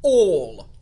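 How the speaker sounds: "All" very loud and weighty; heavily emphasized, stand-alone "All"; great emphasis. A narrator's reading. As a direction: boom out "All" as one stressed word. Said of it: "he repeated with great emphasis".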